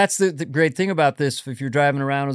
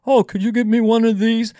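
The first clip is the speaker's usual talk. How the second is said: higher, mildly sheepish request; lightly voiced shy father asking; dad voice; 7 semitones higher, 3 dB louder than usual. Host voicing his father asking for the enema kit, a touch higher. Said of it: "this is my best".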